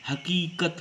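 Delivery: teacher's emphatic हकीकत; stress within हकीकत. Shown as a demonstration. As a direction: lean on the long की in हकीकत. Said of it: "मुख से अधिक बल".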